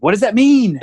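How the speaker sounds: loud, high retold bafflement; mock exasperated confusion; bewildered outburst. Acted bewildered reaction to the news.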